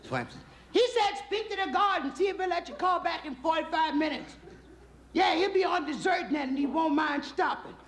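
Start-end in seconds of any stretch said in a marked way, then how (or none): 0.7-4.4 very high falsetto wife; comic housewife voice, way up high; wife relaying message. Acted. 5.1-7.7 very high falsetto wife; put-on woman's phone voice, way up high; wife relaying message. Wife relays husband's words in falsetto.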